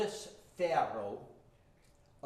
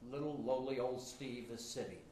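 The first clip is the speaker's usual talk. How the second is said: ordinary talk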